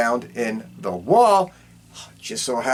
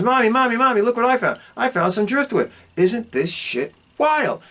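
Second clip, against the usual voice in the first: put-on high, eager child voice calling mommy; excited little kid; 9 semitones higher than usual. Son running home to show his mom the driftwood, high kid voice. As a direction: play the boy running in to show his find.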